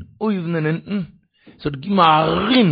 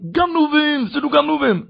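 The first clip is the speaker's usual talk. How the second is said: thief cry; 7 semitones higher, slower than usual. Retold or imitated. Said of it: "דא מען גיט דער געשריי".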